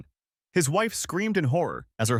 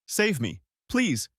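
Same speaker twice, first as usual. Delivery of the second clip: gasping; 8 semitones higher, slower than usual. A narrator's reading. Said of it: "she gasped".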